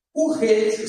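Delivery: usual speaking voice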